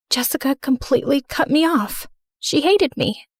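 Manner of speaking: ordinary delivery, nothing marked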